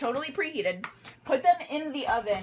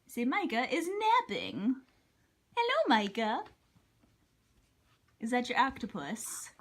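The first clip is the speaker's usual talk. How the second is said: talking to a dog; 3 semitones higher than usual. Real, not acted. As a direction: coo at the puppy. Introducing the dog on camera.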